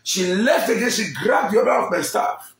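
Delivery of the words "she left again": normal talking voice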